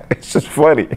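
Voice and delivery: real chuckle around high-pitched words; amused, voice lifted by laughter; laughing. Real, not acted. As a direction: talk through a laugh. Laughs at people's reaction.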